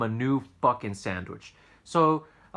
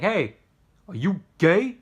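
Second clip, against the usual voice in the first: blunt customer; 9 semitones higher, 9 dB louder, slower than usual. Retold or imitated. Customer blurts an odd question.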